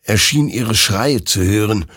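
ordinary delivery

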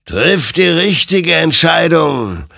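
high, loud, drawn-out exhortation; stern admonition. A narrator's reading. Apparition urging Hector to choose, admonishing loudly. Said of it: "ermahnte ihn sein Gegenüber".

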